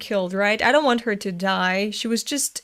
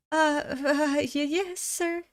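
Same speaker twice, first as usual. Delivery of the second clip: nervous employee; 4 semitones higher, 5 dB quieter, slower than usual. Acted. Voicing a scolded nervous employee.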